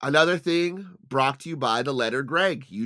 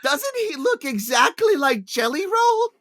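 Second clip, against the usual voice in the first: high put-on old lady voice; mock old lady; 12 semitones higher, 5 dB louder than usual. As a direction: ask the wife like an excited granny. Old lady asking the wife.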